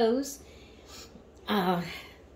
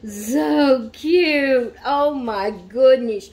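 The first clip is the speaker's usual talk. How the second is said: gushing; 3 semitones higher, 6 dB louder, much slower than usual. Real, not acted. Gushing over new doll.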